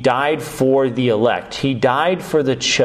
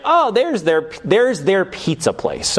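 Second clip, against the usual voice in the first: higher-pitched mock discovery; pleased recognition; 7 semitones higher than usual. Visitor driving through town spots the pizza place, delighted recognition. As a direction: voice a passer-by spotting it, pitched up. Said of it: "and it's like".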